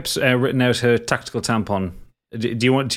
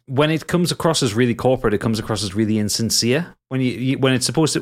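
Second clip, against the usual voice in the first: ordinary talk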